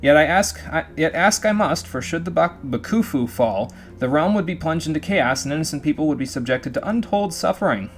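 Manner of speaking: formal in-game letter narration; letter read aloud. A narrator's reading. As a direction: read out an earnest plea, then wind down to the signature.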